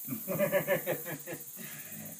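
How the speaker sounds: short chuckle at the name; a quick amused laugh. Real, not acted. Veteran laughing while naming his crew.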